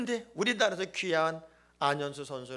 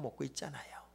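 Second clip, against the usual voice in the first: 숨소리로 낮게 속삭이며; 살짝 속삭이듯 마무리; 속삭임; 6 semitones lower, 11 dB quieter than usual. Real, not acted. (성도들에게 속삭이듯 말끝 흐리기)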